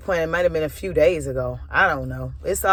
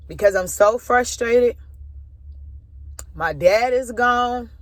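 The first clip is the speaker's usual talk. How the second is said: choked up, higher-pitched, halting; tearful; 5 semitones higher than usual. Real, not acted. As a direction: fight tears between slow, drawn-out phrases. Recounting own breakdown after dad's death.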